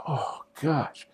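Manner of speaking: whispered groan to oneself; dismayed whisper. Retold or imitated. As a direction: breathe it out softly in dismay. Trainee's dismayed thought after scolding.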